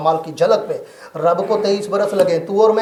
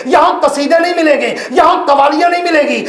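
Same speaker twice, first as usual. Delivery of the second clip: high, loud, rising sermon shouts; fiery preaching; 10 semitones higher, 5 dB louder than usual. Acted. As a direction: thunder each line at the crowd. Preaching at full force. (ऊँची आवाज़ में जोशीली तक़रीर)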